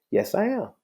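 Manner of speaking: high, calmly smug confirmation; enjoy their discomfort; smug yes. Retold or imitated. Judge calmly confirming to the stunned bailiff, smug. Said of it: "a little smug look on my face".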